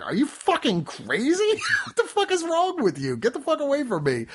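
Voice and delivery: hammed-up disgusted rejection, breaking into laughs; mock outraged heroine. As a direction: play her half-laughing, appalled at him. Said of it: "and she's like".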